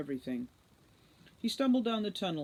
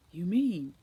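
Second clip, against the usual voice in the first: 1 alarmed question; 6 dB louder than usual. A narrator's reading. Director alarmed.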